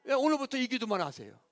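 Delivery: ordinary delivery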